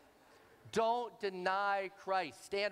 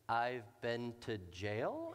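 slow unsure guess; 7 semitones lower, much slower than usual. Retold or imitated. Lady guessing what the tattoo means, slow and puzzled. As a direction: drag out a clueless answer.

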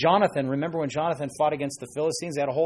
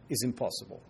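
softer mock Russian accent; murmured put-on Russian accent, lower in level; 3 semitones lower, 8 dB quieter than usual. Doubter with a Soviet mentality, in a mock Russian accent. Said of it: "That's my Russian accent for you".

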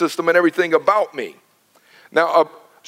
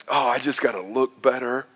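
softer, lower mock whine; mock a vain churchgoer; mock worrier; 7 semitones lower, 6 dB quieter than usual. Pastor mocking people obsessed with looks, soft mock whine. Mimics self-image worrier.

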